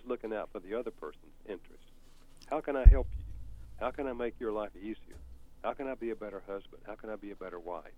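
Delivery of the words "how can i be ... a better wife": ordinary delivery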